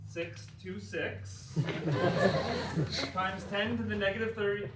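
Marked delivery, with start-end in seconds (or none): 1.5-3.1 short laugh. Real, not acted. Laughs at misread number.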